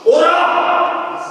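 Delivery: drawn-out, high shouted summons; a slow booming "오라"; commanding call. Acted. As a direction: call out over the storm, long and loud. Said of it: "그렇게 안 하셨다는 거예요".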